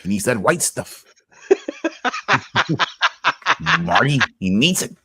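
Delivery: light cartoon scientist voice breaking into laughter; a hint of Rick, cackling. Teasing Marty in a lightly voiced Rick.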